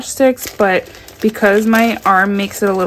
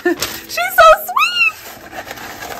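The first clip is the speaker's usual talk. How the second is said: high, slow drawn-out gush; gushing squeal; much slower than usual. Real, not acted. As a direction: stretch it out, overwhelmed with delight. Gushing over the note.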